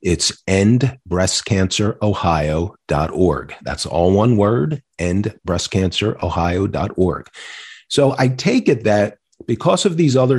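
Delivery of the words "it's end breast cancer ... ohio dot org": slow, careful web address read-out; measured, word-by-word dictation pace; slow and clear. Real, not acted. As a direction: spell it out slowly for note-takers. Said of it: "I'll say it slowly".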